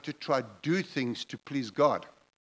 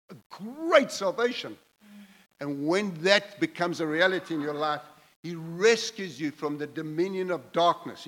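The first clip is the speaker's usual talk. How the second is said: raised, higher-pitched, impassioned preaching; preaching at full force; 4 semitones higher than usual. Real, not acted. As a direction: preach it out, building up.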